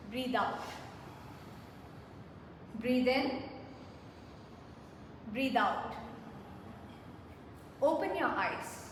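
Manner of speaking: slow, calm breathing instructions; guided breathing. Acted. Trainer leading the staff in breathing, slow and calm. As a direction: guide the room through each breath, unhurried.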